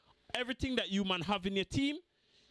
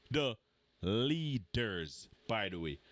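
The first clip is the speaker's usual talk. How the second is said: sarcastic; 10 semitones lower, slower than usual. Real, not acted. Sarcastic aside on "leaders.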